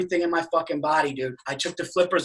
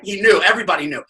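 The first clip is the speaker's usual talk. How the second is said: ordinary talk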